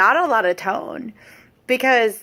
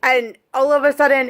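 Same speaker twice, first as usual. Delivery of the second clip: ordinary delivery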